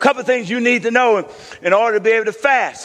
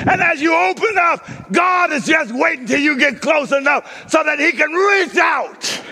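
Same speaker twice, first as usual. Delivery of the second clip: full-force preaching; 8 semitones higher than usual. Real, not acted. Preacher likening the game to God, high full-force shouting. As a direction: drive it home at the top of your voice.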